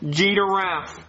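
"Giraffe" said as "jee-duh-raff"; low-pitched "Giraffe" with an added D; mispronounced giraffe. Acted. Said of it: "There's no D".

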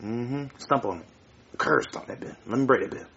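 soft, low conspiratorial coaxing; hushed urging. Acted. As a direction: lean in and coax quietly.

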